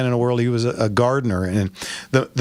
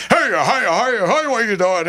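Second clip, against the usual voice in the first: put-on higher, chirpy old-timer calling hiya; a higher, friendly old man saying hiya, hiya; old Charlie's greeting; 8 semitones higher than usual. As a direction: greet the kid like a cheery old regular at the window. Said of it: "just the nicest man in the world".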